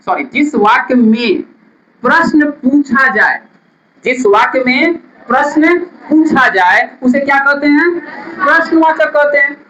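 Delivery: normal talking voice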